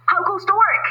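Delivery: farmer calling. A narrator's reading. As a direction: call out as the lazy farmer. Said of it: "called a farmer".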